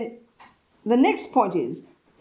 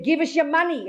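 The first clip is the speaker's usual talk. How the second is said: retold mugger's demand, pitched higher; young robber demanding money, high; robber's demand; 7 semitones higher than usual. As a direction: replay the mugger's threat. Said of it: "He said".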